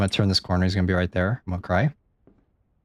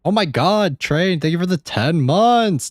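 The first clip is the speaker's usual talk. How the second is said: excited thanks; 6 semitones higher, 3 dB louder than usual. Real, not acted. Thanking donor on stream.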